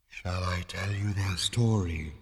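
slow, hushed spectral inquiry; quiet ethereal voice. A narrator's reading. Disembodied voice offering Zaphod a story, quietly. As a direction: offer it gently, like a spirit at the ear. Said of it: "inquired the voice quietly".

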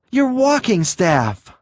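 high, drawn-out agitated cry; frantic high-pitched gasp; gasped exclamation. A narrator's reading. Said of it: "Green gasped".

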